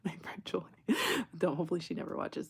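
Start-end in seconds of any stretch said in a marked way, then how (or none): 0.1-0.6 whispered aside. Real, not acted. Trailing aside.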